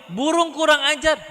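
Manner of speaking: loud, high mock-angry scolding; scolding the bird. Man hit by droppings tells off the bird, angry shout. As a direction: yell a telling-off up at the bird. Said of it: "Marahin burungnya".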